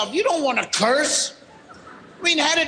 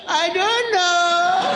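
put-on drawn-out, higher-pitched helpless kid; clueless child; 4 semitones higher, much slower than usual. Child answering why he did it, clueless drawl. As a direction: stretch it out like a stumped child.

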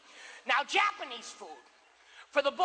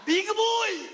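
Lord Toranaga; 2 semitones higher, much slower than usual. Retold or imitated. Sushi owner yelling at a big eater, warlord shout. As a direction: call out like a commanding warlord. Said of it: "reminded me of Lord Toranaga from Shogun".

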